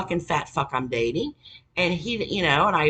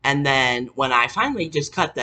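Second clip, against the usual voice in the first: ordinary talk, nothing marked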